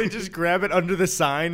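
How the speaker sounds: ordinary talk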